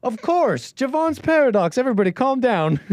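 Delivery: a sweating CEO insisting everyone calm down; nervous sweating voice. Retold or imitated. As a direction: play it cool while clearly panicking. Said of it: "a thought comes to you".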